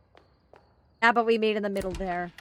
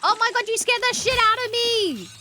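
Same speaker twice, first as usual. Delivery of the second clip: shrieking in sudden fright, high and loud; jolted by the jump scare, shriek it; startled scream; 13 semitones higher, 6 dB louder than usual. Real, not acted. Streamer startled by a jump scare, screaming in fright.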